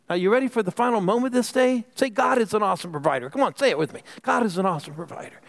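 high, urgent preacher's call-and-response; rally the room to repeat it; preaching at full force. Real, not acted. Pastor leading congregation in repeating the refrain, fervent.

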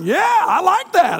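high, slow pumped-up yes; fired up by the commands; hammed-up macho enthusiasm; excited agreement. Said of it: "And, and I'm like".

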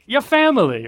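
high, loud Italian mobster take. Retold or imitated. Mark mimicking in-laws as Italian mobsters, high and loud. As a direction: say it like a mob boss welcoming. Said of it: "Holly's family are not Italian mobsters. I don't know why I said it like that".

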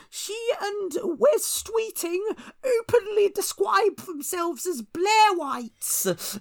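very high, slow, drawn-out wail; mocking falsetto quote. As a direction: draw each word out in a squeaky mock cry. Mocking quote of a political journalist.

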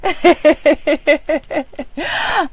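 light, quick giggle; a giggle running on in a rapid string; real tickled giggle; laughing. Real, not acted. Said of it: "I'm laughing because".

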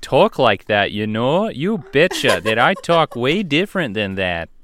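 slow mock Minnesota accent of the offended. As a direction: play an indignant Minnesotan, stretching the words. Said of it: "they are angry right now".

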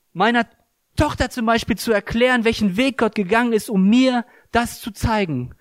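normal talking voice

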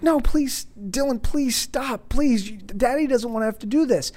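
pleading. Retold or imitated. Father begging his screaming son to stop, high pleading.

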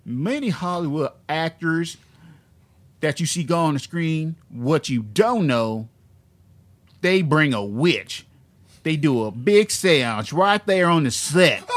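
slow, hammed-up Southern preacher; dumb Southern accent. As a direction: draw it out like a Southern pulpit warning. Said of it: "here's another funny part he said".

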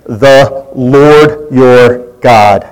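solemn slow reading. Acted. Reading God's words to Moses.

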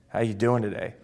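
low, soft "How you doing today"; subdued greeting. Shown as a demonstration. Coach demonstrates tone of voice, subdued. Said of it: "I just said the same phrase five different ways".

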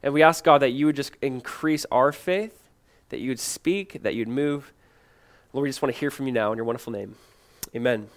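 soft, hushed prayer to God. Acted. Pastor praying softly over the congregation before the message. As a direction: pray it quietly and humbly.

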